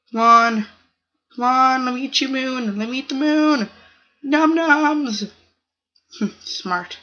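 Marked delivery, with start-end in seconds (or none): 0.1-5.3 higher, louder eager cries; hungry, gleeful yells while chasing; excited shouting. Real, not acted. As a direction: cheer yourself on in the chase. Chasing the Moon player.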